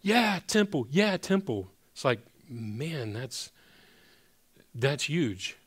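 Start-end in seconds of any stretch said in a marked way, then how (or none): 0.0-1.7 mock believer enthusiasm. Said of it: "we're like".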